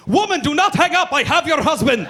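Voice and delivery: high, very loud mock-accented command; accented cab driver. Cab driver answers when wife calls back, shouting in mock accent. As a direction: shout it urgently as the foreign cabbie. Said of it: "He goes".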